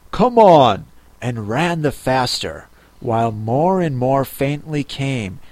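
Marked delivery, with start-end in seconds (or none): 0.1-0.9 louder, urging onward; urgent call. A narrator's reading. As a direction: call back while running. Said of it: "the Gryphon only answered".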